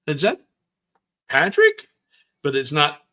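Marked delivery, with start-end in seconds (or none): 0.1-1.9 sharply rising, surprised; surprised question. Retold or imitated. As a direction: ask it in disbelief. Retold surprise at seeing Patrick.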